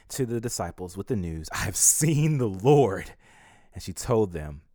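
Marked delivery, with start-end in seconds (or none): 1.5-3.1 loud, slow, raised announcement; drawn-out, emphatic declaration; joyful proclamation. A narrator's reading. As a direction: proclaim the news triumphantly. Mary announces the news.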